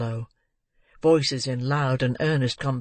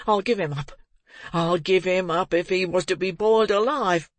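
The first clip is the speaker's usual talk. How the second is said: put-on frantic boy shouting; 7 semitones higher than usual. A narrator's reading. Boy calling the men to seize the murderer, frantic high shouts. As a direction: scream for help, rising to a frenzy. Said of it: "becoming more and more excited as he spoke".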